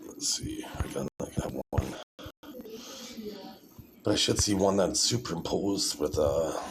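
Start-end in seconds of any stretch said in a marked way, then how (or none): 0.0-2.0 very soft, low mutter; thinking aloud. Real, not acted. Vlogger scrolling for the right video, muttering to himself. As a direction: mumble under your breath while searching.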